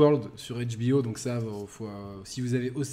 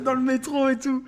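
higher and laughing through the words; giggling, raised voice; 12 semitones higher, 4 dB louder than usual. Real, not acted. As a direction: talk while still cracking up. Still laughing at the joke.

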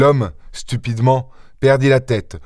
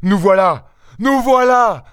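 high-pitched call, climbing on the repeat; 9 semitones higher than usual. A narrator's reading. Eldest son running to answer the cries, calling out. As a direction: call ahead urgently, pitch jumping up. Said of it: "proféra l'aîné qui courait en avant".

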